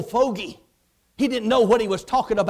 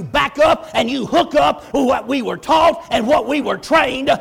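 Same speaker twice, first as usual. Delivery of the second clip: full-force preaching; 4 dB louder than usual. Real, not acted. Preacher standing in for Dad Hagin, shouting hard. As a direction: hammer the point home with fire.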